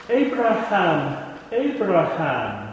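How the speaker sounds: slow, drawn-out calling of the name. Shown as a demonstration. Voice from heaven calling Abraham, slow and drawn out. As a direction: stretch each name out, unhurried. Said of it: "by the fact that the text repeats it twice".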